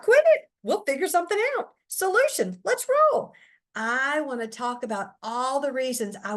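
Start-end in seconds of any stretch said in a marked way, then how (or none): 0.0-3.3 much higher and louder, fast and eager; upbeat husband pitching a fix. Retold or imitated. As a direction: bounce in like a cheerful fix-it husband. Husband voiced in her story.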